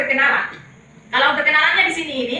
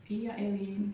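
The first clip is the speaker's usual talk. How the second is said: soft prompt; 5 semitones lower, 14 dB quieter, slower than usual. Shown as a demonstration. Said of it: "Baru Dela?".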